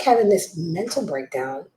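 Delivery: ordinary delivery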